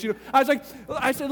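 ordinary delivery

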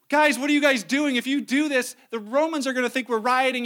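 scolding mayor. Retold or imitated. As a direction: call out to calm the mob.

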